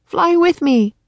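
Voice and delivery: high raven character voice; croaking. A narrator's reading. Teddy flying by the others, high croaking call. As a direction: announce it high, like a bird calling. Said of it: "he croaked".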